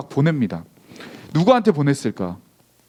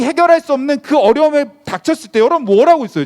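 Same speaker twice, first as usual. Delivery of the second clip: building, louder sermon climax; preaching at full force; 7 semitones higher, 4 dB louder than usual. Acted. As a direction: drive the list of problems home, rising with each one.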